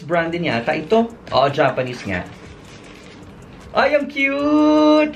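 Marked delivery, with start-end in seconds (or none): 3.8-5.1 high, slow gush; real delight, pitched high; delighted squeal. Real, not acted. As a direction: coo over the cute gift. Delighted by cute speaker.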